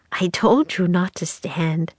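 plain reading voice

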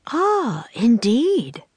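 high, swooping "ah" of realisation; pitched well up, pleasantly surprised; impressed interest. A narrator's reading. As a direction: react with lifted, intrigued voice. Governor reacts to boy's story.

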